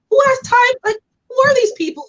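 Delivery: who even does this, said with disbelief; exasperated. Real, not acted. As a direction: trolls online, with high indignant disbelief. Exasperated at online trolls.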